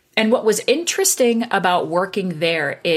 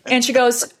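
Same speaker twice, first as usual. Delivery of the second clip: ordinary delivery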